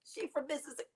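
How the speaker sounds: high whispered teasing question; mocking viewer. Mocking what viewers wonder about her accent, in a high whisper. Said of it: "You wonder why I keep saying it like".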